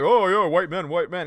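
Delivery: loud mocking caricature of her; mock outrage. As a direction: sneer her slogan out loud. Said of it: "she's trying to be like".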